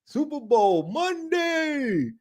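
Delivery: stretched high, slow, playful; drawn-out high mock call. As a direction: sing out each word, high and slow. Repeats the name, mock high drawn-out.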